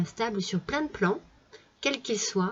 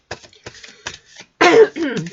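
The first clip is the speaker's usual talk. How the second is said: a sudden loud harsh cough; 6 semitones higher, 11 dB louder than usual. Real, not acted. Reader with a cold coughs before apologising, loud. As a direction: cough hard from a head cold.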